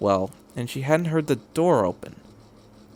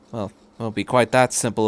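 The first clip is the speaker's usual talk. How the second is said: usual speaking voice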